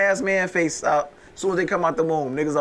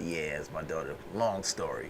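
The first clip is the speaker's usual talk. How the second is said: put-on low tired old man; 11 semitones lower, 12 dB quieter than usual. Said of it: "He said".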